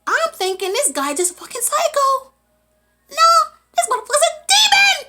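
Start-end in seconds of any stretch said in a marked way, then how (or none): 3.2-5.0 high, loud, fast burst; shocked exclamation. Retold or imitated. Storyteller revealing the villain is a demon, shocked exclamation. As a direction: blurt it out, punching demon high.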